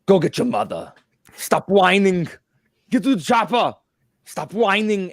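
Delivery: loud, high, booming Arnold; Arnold impression. Host imitates Arnold on a soundboard prank, loud. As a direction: bark it like Schwarzenegger. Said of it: "they hear Arnold go".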